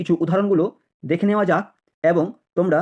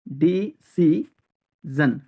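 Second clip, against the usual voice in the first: Decision slowly as di-si-zon; long pauses between syllables of decision; much slower than usual. Shown as a demonstration. Teacher drilling learners, decision in slow separate syllables.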